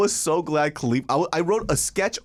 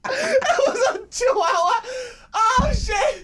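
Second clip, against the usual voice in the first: high, slow, shrieking through laughter; can barely get the punchline out for laughing; laughing hard; slower than usual. Real, not acted. Telling the podcast cohost the twist, shrieking laughter.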